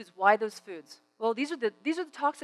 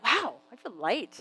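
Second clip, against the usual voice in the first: pleased wonder; slower than usual. Acted. Acts out feeling after fruit.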